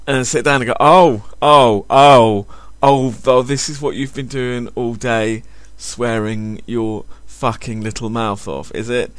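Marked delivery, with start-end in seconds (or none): 0.8-3.4 shocked parent. Pretend. Parent shocked watching child's swearing video. 3.4-9.1 disapproving parent. Pretend. Parent confronts swearing child actress.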